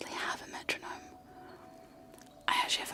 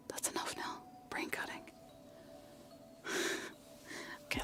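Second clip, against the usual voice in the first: usual speaking voice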